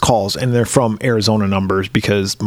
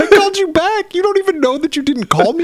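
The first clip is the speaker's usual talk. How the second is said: high, exasperated mock outrage; incredulous. To the scam caller who phoned back, high mock outrage. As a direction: fire back at the caller in disbelief.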